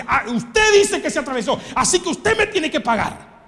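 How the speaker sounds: hammed-up angry driver; mock aggressive demand. Acted driver demanding payment. (conductor exigiendo con enojo)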